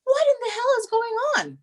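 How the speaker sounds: high, incredulous shriek; a mother finding the kids in chaos, high shocked; mock alarmed outburst. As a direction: squeal it, caught off guard by chaos. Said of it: "And I'm like".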